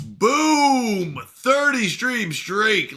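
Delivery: slow, drawn-out hype call; excited celebration. Real, not acted. Streamer celebrating John's thirty stream streak, excited hype. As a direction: boom it out like an announcer.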